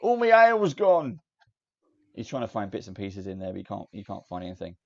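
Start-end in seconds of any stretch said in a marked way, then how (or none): 0.0-1.2 furious dwarf shout, high and loud; shouting and cursing. Acted. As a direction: bellow it out in outrage. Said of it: "shout and curse".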